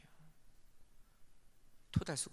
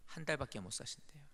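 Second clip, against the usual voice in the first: whisper; 7 dB quieter than usual. Real, not acted. Telling of father's terminal cancer.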